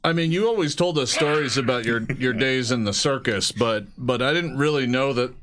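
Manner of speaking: normal talking voice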